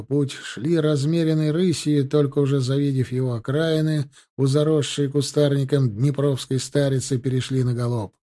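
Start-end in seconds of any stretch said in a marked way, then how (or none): none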